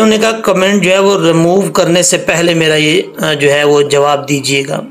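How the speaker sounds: ordinary delivery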